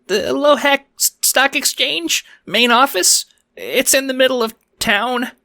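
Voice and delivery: high, timid little bank teller; squeaky and unsure, stumbling; nervous high-pitched croc voice. Acted. Nervous small croc teller answers.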